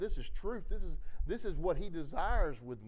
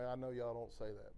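normal talking voice

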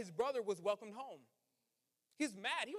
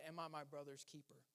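softer, drawn-back asking of the question; quiet, searching question; 6 semitones lower, 12 dB quieter than usual. Shown as a demonstration. Pastor quietly poses Cain's question to the church. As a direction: let it sink in under your breath. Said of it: "I'm gonna pose that question to you".

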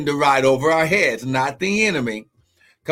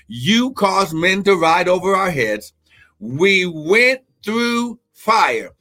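preaching at full force; 8 semitones higher than usual. Acted. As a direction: climb into the preaching peak. Preaching climax, high pitched.

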